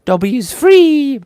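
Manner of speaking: high, loud and slow elf squeak; high squeaky elf cry; Dobby voice. Acted. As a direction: proclaim freedom as Dobby. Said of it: "I'm not a voice actor".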